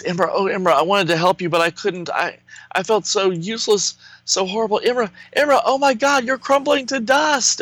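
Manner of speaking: a high, mildly voiced lament; crying. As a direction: plead with his lost love, hammed-up. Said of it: "And Lightning Lad's crying".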